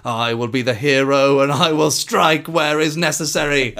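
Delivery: mock-epic vow, rising high and loud; hammed-up hero declaring his moment; heroic self-talk. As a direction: proclaim it grandly, swelling as you go. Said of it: "this man is speaking to himself".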